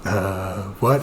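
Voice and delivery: low, soft, groggy mumble; sleepy tentmate. Retold or imitated. Tentmate woken at one o'clock, groggy. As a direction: answer as if just dragged from sleep. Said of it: "wakes up and goes".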